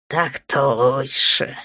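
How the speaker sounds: slow, satisfied drawl; contented drawl. A narrator's reading. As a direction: stretch it out, pleased with himself. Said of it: "довольно протянул Торн". (довольно, нараспев)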